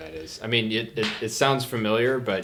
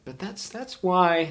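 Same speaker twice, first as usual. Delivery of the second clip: fussy professor; 10 semitones higher, slower than usual. Acted. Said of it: "He's like".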